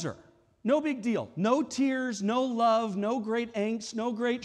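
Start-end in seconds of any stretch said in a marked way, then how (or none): none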